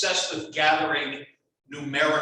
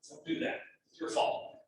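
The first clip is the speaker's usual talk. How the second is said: joking whisper; 8 dB quieter than usual. Real, not acted. Teasing students for distracting him.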